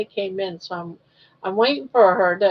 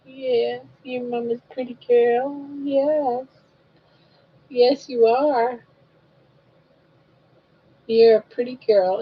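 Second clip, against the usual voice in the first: sing-song coaxing, pitch rising toward the end; lightly lilting mama voice; baby talk; 3 semitones higher than usual. Acted. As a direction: coo at the baby to win a smile.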